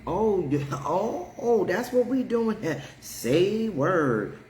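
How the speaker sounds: hammed-up indignant reply to the boss; sassy comeback. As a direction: snap back in a put-on sassy voice. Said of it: "I automatically turned into".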